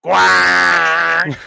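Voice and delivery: very loud, long drawn-out quack; duckling quack. Retold or imitated. Podcaster imitates the ugly duckling hatching, blaring quack. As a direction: blare out one hammed-up quack. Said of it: "And he's going".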